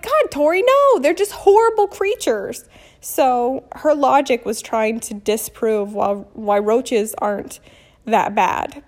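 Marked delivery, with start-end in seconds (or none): 0.0-2.6 higher and louder, fed up; exasperated outburst. Retold or imitated. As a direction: cut off the questions in frustration. Exasperated with daughter's questions.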